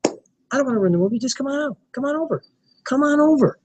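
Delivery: high, eager sing-song invitation; inviting upbeat lilt. Shown as a demonstration. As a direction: pitch it up and lure them in warmly. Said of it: "Your inflection".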